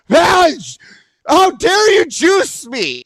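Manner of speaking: high, slow hammed-up shout; drawn-out indignant cry in jest; mock outrage. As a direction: play at being grandly offended. Mock outrage at thrown juice.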